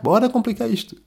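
high, eager voice of the overthinking mind; lightly voiced inner schemer; mock brain voice. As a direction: play the inner complicator egging him on. Voicing his overthinking brain.

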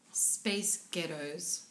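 "space ghettos" in an American accent; slow, low American accent. Shown as a demonstration. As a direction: demonstrate the American accent slowly. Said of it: "in an American accent".

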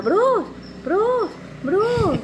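high, loud mock scream for a husband; retold shouting. Mother re-enacts calling her husband over a tiny cut, shouting high. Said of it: "yo comencé a gritar".

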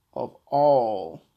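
all of, with all pushed out loud and long; emphasis on all. Real, not acted. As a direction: lean hard on the word all. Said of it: "putting emphasis on the word all".

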